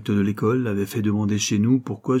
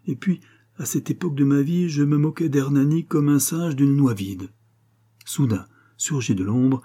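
plain reading voice

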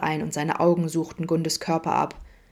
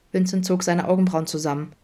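plain reading voice

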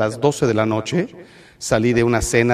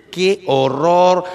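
mock horror; 4 semitones higher, much slower than usual. Real, not acted. Horrified at the corner.

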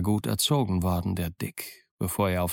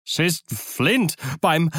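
a frightened outcry, higher; terrified cry; 6 semitones higher, slower than usual. Acted. Pirate cries out to his crewmates in terror. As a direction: blurt it out in panic. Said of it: "schrie Mary".

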